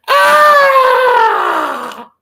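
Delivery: long, high, loud mock scream. Woman screaming on meeting him, acted loud shriek. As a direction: shriek at full volume as if horrified by someone. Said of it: "come up to me and go".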